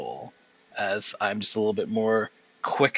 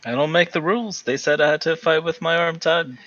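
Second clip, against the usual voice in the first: higher, louder defensive protest; indignant protest; 8 semitones higher, 6 dB louder than usual. Acted. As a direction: push back against the accusation. Fighter protests to opponent in character.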